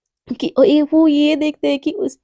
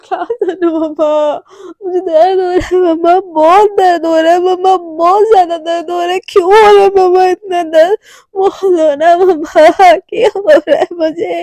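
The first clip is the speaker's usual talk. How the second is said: heaving sobs; 2 semitones higher, 4 dB louder than usual. Acted. Daughter sobbing to her mother about the pain, heaving sobs. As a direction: sob out the pain between breaths. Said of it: "ऐसे बहुत ही उठ उठ के ना रोने लगती है".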